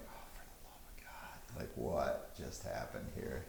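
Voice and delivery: whispered, fed-up aside; weary whisper. Acted. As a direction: breathe it out under your breath in dismay. Kaelin voiced by GM, aside.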